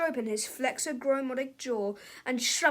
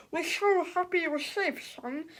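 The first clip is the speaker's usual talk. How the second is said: higher slow relieved voice; relieved father; 4 semitones higher than usual. Acted. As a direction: tell your son you are glad. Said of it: "cried Mister Sneedley".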